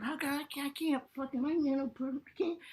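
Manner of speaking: high, strained mock whine; mock frustration. Comic trying to see own body, high frustrated whine. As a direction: sputter like you can't reach it. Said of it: "I'm just like".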